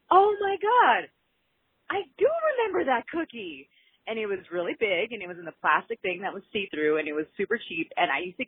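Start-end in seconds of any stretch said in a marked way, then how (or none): 0.1-3.7 high, delighted gasp of memory; excited recall. Retold or imitated. Caller telling hosts she remembered the cookie, excited and high. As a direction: squeal it like the memory just hit. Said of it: "I was just like".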